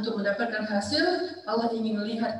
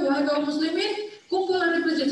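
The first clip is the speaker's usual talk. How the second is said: raised, higher call to the crowd; 6 semitones higher than usual. Retold or imitated. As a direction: summon the faithful across the mosque. Sultan calls people to the mosque.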